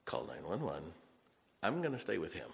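leaning over to a teacher, quietly; quiet aside. Retold or imitated. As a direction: murmur it so the kid can't hear. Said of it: "I gently lean over".

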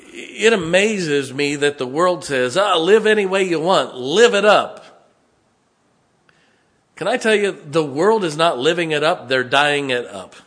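loud, high-pitched sermon delivery; impassioned preaching. Real, not acted. Preacher warning church about the world, impassioned preaching. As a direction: preach it out with force.

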